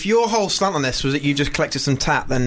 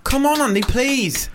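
high-pitched needy begging, hammed-up; mock pleading; 7 semitones higher, slower than usual. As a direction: beg your mate for a laugh. Begging co-host for laughs.

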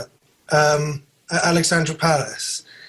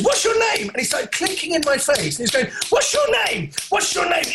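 high insistent mock shout; imitating a pushy fan; 11 semitones higher than usual. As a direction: badger him with the question. Fan pestering him for name.

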